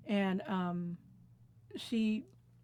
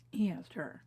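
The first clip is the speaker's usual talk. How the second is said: hushed repeat. Real, not acted. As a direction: repeat it almost under the breath. Repeats the line hushed for emphasis.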